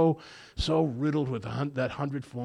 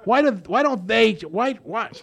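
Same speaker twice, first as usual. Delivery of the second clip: higher, louder whiny why-me; mock whine; 8 semitones higher, 7 dB louder than usual. Sponsor mocking sponsees who want others to change, a higher, louder mock whine. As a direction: ham up a self-pitying sponsee. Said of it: "We all say that".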